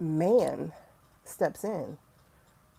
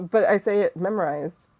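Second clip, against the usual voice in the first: normal talking voice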